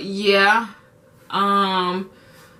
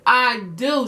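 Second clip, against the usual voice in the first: ordinary talk, nothing marked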